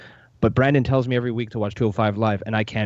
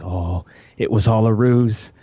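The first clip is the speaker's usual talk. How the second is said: excited realisation; 6 dB louder, slower than usual. Retold or imitated. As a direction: shout out the discovery. Realising a twist while watching.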